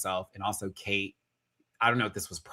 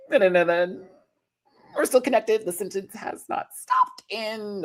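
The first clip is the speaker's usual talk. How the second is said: Obama keeping the sentence going, higher and halting; Obama impression; 12 semitones higher than usual. Showing how Obama's sentences stay connected, in a higher mock voice. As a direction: hold the sentence open like Obama. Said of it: "That's Obama".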